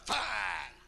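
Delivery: high, blunt snapped question; grumpy mother-in-law. Retold or imitated. Mother-in-law answering son-in-law's call, high and curt. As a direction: answer the phone with a snap. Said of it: "How you doing".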